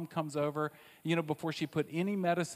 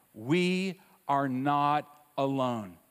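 slow emphasis; 4 dB louder, much slower than usual. Real, not acted. Slow key statement.

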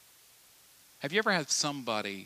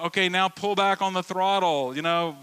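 higher, louder radio command; mock controller; 6 semitones higher, 8 dB louder than usual. Controller talks pilot through, higher and louder. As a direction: call it in like the tower.

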